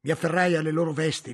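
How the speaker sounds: usual speaking voice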